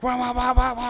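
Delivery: high, loud wah-wah squeals; wheel-spin noise by mouth; imitated spinning wheels. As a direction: mimic truck wheels spinning out. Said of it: "it starts spinning the wheels".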